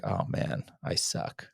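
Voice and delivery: hushed whisper of a self-critical thought; whispered self-doubt. Retold or imitated. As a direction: breathe it out like a private put-down.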